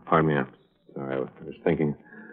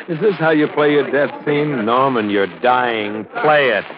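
taunting; 8 semitones higher, 4 dB louder than usual. Acted. Killer taunting dying man.

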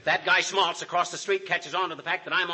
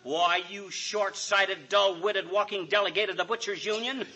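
angry tirade; 2 semitones higher than usual. Acted. Husband hurls insults at the doctor, building to a shout. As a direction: work yourself up into a yell.

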